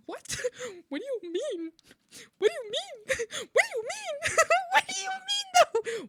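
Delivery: very high squealed disbelief, breaking into laughs; incredulous. Retold or imitated. Acted disbelief at absurd accusation.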